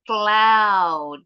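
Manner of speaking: Cloud with an open OW as in 'now'; correct 'ow' vowel. Shown as a demonstration. Teacher models the right vowel for students, drawn-out OW in Cloud. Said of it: "jadi bukan cloud".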